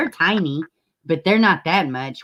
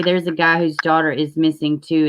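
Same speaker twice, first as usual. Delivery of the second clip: ordinary talk, nothing marked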